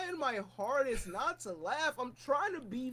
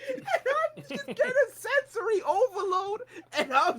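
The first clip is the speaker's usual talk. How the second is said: high, laughing retelling; helpless hilarity; 10 semitones higher, 3 dB louder than usual. Real, not acted. Telling friends about sensory overload at panel, high and laughing. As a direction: tell it while cracking up, pitched way up.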